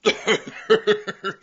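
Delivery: high burst of laughter; mocking, incredulous cackle; derisive laugh. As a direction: laugh outright at a ridiculous boast. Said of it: "Laugh at me, will you?".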